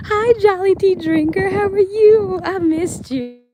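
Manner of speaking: high, loud sing-out hello; excited greeting. Real, not acted. Greeting a friend who joins the live, excited and high. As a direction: squeal a delighted welcome, drawn out.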